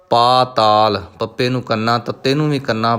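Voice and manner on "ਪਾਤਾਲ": ਪਾਤਾਲ with a long first vowel, ਪਾ held; correct pronunciation. Shown as a demonstration. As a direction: say ਪਾਤਾਲ with kanna on both syllables. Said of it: "ਪਤਾਲ ਨਹੀਂ ਪੜ੍ਹਨਾ". (ਪਾਤਾਲ ਲੰਮੀ ਆਵਾਜ਼ ਨਾਲ)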